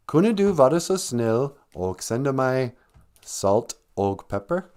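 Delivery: slow, careful Norwegian sentence; speaking Norwegian. Shown as a demonstration. Showing what a learner can say, slow careful Norwegian. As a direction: recite the Norwegian slowly. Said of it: "now I can say".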